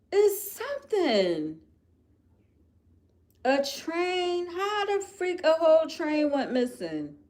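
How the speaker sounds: high, loud incredulous ranting; venting that a whole train vanished; outraged disbelief. Real, not acted. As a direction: rant in stunned disbelief.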